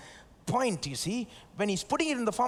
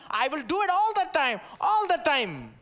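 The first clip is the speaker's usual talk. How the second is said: higher, louder proclaiming; God's promise; 5 semitones higher, 4 dB louder than usual. Acted. Preacher voicing God to the church, raised and proclaiming. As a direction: ring out the promise. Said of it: "when God says".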